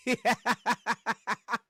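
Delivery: long, rhythmic run of laughter; real laugh. Real, not acted. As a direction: burst out in a steady cackle. Bursts out laughing at comment.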